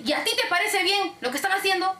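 mock-outraged question; annoyed customer. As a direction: challenge as an indignant caller.

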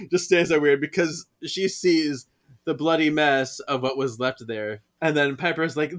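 ordinary delivery, nothing marked

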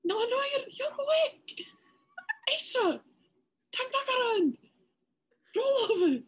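high, loud whiny pleading; pleading protest. Acted. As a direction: beg her to turn back. Character pleading, calling to her.

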